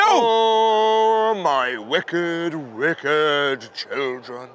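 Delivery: slow, low, grave lament; a deep, slow, grieving divine voice; sorrowful God. Acted. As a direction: mourn over wayward children like a deep old god. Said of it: "says God".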